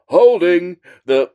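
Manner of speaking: slow, drawn-out American announcer voice; mock NFL referee announcement; generic American ref. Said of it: "the same generic American voice".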